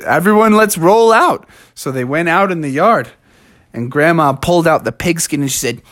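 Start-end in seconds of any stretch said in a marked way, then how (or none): 0.0-1.4 higher, punchy rallying cry; hearty team rally; rallying call. Acted. Rallying the family to play.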